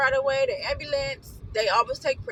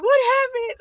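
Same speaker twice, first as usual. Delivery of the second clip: high, loud frantic shout; panicked shout; 12 semitones higher, 9 dB louder than usual. Retold or imitated. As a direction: yell it in full panic. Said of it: "She was just flipping out".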